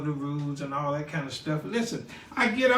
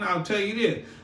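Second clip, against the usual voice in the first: normal talking voice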